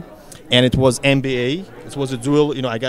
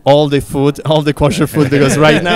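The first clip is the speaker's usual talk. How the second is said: playful joking; 8 dB louder than usual. Real, not acted. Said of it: "I'm fooling around a little bit here".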